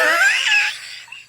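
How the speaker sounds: shrieking high cackle; wild impression laugh. Real, not acted. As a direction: let out a silly character laugh. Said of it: "Why are you doing a Wingo impression?".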